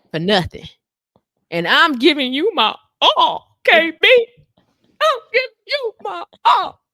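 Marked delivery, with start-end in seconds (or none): 1.5-6.8 high, loud, slow whining plea; whiny plea. Retold or imitated. Husband whining to his wife, high loud slow whine. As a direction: whine like a hurt man begging. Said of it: "that make me even more mad".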